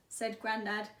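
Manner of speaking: plain reading voice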